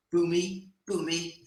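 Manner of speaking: Al Iaquinta. Retold or imitated. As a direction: jeer it like a fighter baiting the crowd. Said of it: "doing an Al Iaquinta".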